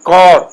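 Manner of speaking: کور with a rounded "aw"; Persian long vowel. Shown as a demonstration. Teacher repeating the correct Iranian form, Persian long vowel. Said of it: "تلفظ او کا آئے گا".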